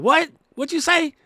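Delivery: high, loud, irritated mock yell; annoyed shout. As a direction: snap back like you've been bothered mid-task. Voicing someone annoyed at interruption.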